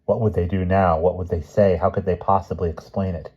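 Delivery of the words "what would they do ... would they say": usual speaking voice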